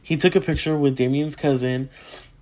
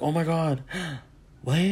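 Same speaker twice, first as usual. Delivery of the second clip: soft gasping disbelief, acted; starstruck shock; 8 dB quieter than usual. Hearing of more famous people, acted shock. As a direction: gasp and trail into a hushed "what".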